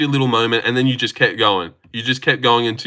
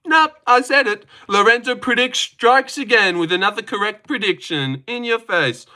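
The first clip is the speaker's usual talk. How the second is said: higher, hyped boast about himself; gloating announcer; 8 semitones higher than usual. Acted. Streamer gloats at chat, lightly hammed-up announcer voice. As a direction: announce your own win like a ringside hype man.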